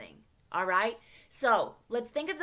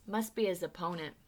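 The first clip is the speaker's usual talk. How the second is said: plain reading voice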